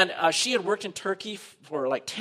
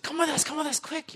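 excited urging; 10 semitones higher than usual. Retold or imitated. Excited girls hurry the missionaries.

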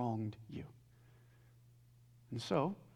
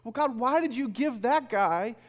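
raised, louder voice, protesting to God; frustrated complaint; 9 semitones higher, 8 dB louder than usual. Retold or imitated. Seminary student to God over another man's job, frustrated. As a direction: complain at higher pitch, with indignant force. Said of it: "I used to get so frustrated and say".

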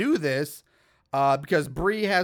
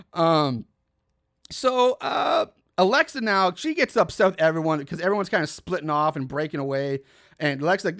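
ordinary talk

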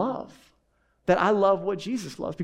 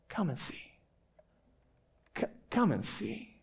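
quietly, almost under the breath, "come and see"; soft invitation; 9 dB quieter than usual. Acted. Philip gently inviting the doubting Nathanael, hushed and soft. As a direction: draw them in softly, like a gentle offer.

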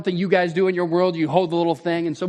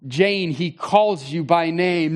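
ordinary talk, nothing marked

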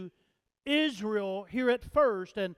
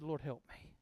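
very soft, low prayer; hushed plea; 8 semitones lower, 16 dB quieter, faster than usual. Retold or imitated. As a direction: pray it under your breath. Voicing the mother's humble prayer.

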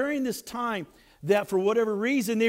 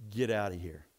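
low, quiet brush-off; low-voiced curt captain; dismissive captain; 13 semitones lower, 8 dB quieter than usual. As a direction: send him away in a low, soft voice. Captain curtly rejects the runaway.

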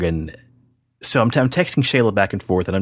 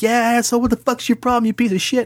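high-pitched mock female heckling; much higher, mocking indignant women; imitating angry coworkers. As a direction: pile on like a friend backing her up. Voices angry coworkers behind her.

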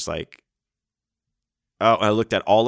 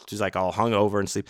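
usual speaking voice

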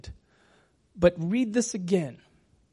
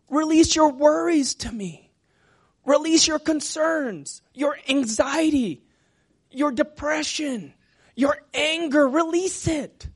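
high, raised, pleading exhortation; fervent appeal in a higher pitch; impassioned preaching; 9 semitones higher, 5 dB louder than usual. Acted. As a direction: urge them to let it all go. Voicing God urging release of burdens.

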